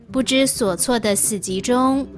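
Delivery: ordinary talk, nothing marked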